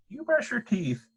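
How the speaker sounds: lightly voiced father; father's gentle instruction, lightly voiced. A narrator's reading. Father's voice in read story.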